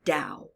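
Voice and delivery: Dhow said slowly and clearly as "dow", one low falling syllable; Dhow, taught slowly and clearly, rhyming with "now"; word demo. Shown as a demonstration. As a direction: model the word Dhow for children to copy, like "how" with a d. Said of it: "Can you say that?".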